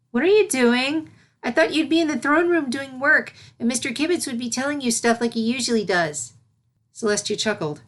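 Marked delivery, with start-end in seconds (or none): 0.1-6.3 high, chatty little voice full of questions; an inquisitive kid puzzling it out; curious filly. Acted. Voicing the young filly.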